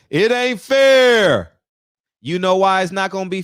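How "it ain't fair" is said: slow, loud mocking whine, dragged out. Mocking the fans who will cry it ain't fair, slow and loud. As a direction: drag out the complaint like a sulky fan.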